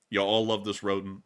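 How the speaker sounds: normal talking voice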